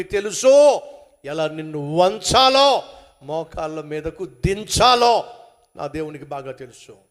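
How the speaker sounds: loud, slow, stern warning; loud and slow, every word a warning; thundering warning. Acted. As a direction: boom it out as a threat from God. Preacher warning loudly.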